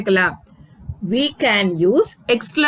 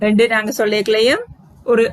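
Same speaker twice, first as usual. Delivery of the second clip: ordinary talk, nothing marked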